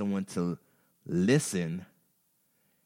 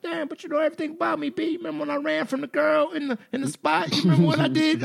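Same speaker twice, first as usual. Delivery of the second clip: much higher, fast, pleading voice; high excited plea; faster than usual. Retold or imitated. Pleading to friends who missed his openness, high and fast. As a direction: squeak it out fast like you're begging them to remember.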